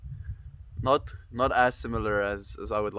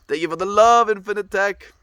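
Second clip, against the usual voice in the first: excited thanks; 10 semitones higher, 10 dB louder than usual. Real, not acted. Thanking a viewer for love.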